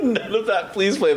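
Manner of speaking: laughing through the words. Real, not acted. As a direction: giggle while scolding. Laughing reaction.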